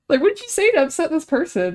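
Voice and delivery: high, loud incredulous question; baffled. Retold or imitated. Retelling her question to coworkers about the ex-coworker, baffled. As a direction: demand it in amused disbelief.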